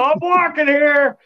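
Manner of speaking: high, slow mock Boston tough guy; Boston accent. Mocking the Australian caller with a Boston line. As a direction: ham up a Boston street accent. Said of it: "I'm just waiting for you to go".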